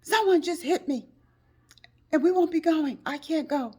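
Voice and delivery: high, loud upset voice; shaken friend. Retold or imitated. Friend telling of the crash, upset and loud. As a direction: gasp it out after the crash. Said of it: "She says".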